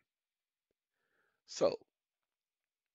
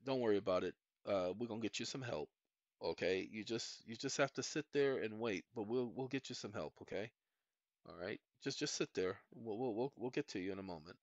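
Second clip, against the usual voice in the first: mock soothing; 6 semitones lower, 6 dB quieter than usual. Mock soothing the ones who don't understand.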